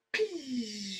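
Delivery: quick high sound effect; imitated whoosh. Mimicking the shot coming at him, high swish. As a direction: make the swish of the blade.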